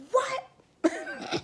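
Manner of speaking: very high, loud yelp breaking into laughter; mock shock. Youth pastor hearing about pregnancy, mock shock. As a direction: squeal it in hammed-up disbelief, then laugh.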